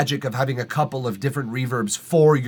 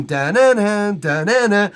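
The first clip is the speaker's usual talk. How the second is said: piano imitation; 7 semitones higher, 5 dB louder than usual. Shown as a demonstration. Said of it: "the piano is doing".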